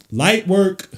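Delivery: drawn-out, high and loud, savouring each word; stretched hype. Real, not acted. Streamer savouring Brunson's bucket, drawn-out loud repeat. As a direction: stretch it out, loving the play.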